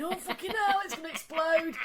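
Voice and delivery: comic fretful outburst; retold panic. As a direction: laughingly recall being alarmed. Panicking at steaming washing machine.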